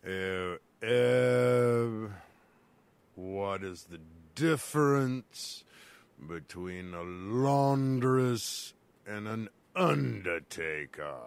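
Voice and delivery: slow deep voice. Acted. Slow low voice asks riddle.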